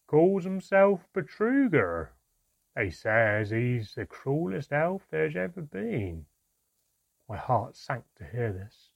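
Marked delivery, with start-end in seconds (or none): 0.1-6.3 slow, loud, raised dwarf voice; loud, slow, ominous dwarf gossip; second dwarf. A narrator's reading. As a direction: draw out the rumour loudly as a dwarf. Second dwarf describes cruel leader.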